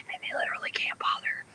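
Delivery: whispered aside; whispering. Real, not acted. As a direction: drop to a whisper.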